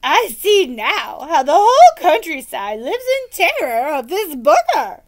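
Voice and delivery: put-on loud but grave old knight; a lightly voiced solemn knight. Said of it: "Sir Fartslot said gravely".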